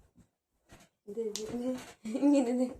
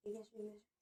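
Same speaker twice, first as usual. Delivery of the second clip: much softer, almost breath only; whispering in the ear; 13 semitones higher, 20 dB quieter, faster than usual. Real, not acted. Wife softly whispers to husband to come closer. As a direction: draw him close and whisper. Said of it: "কানে কানে".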